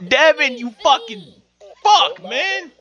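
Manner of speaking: high annoyed yell; exasperated outburst. Real, not acted. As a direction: blurt out in frustration. NPC interrupts the flirting.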